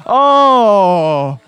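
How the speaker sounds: long, loud "oh" of reaction; loud exclaimed oh. Real, not acted. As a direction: cry out at the throw. Sponge thrown at a face.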